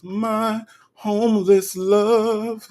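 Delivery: high, slow and stretched-out words; hammed-up, lingering delivery; dramatic drawn-out repeat. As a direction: draw out every word in a high, theatrical croon. Dramatic repeat of viewer's phrase.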